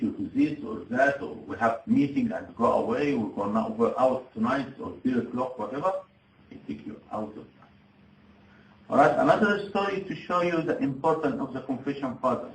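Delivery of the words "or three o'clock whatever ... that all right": ordinary delivery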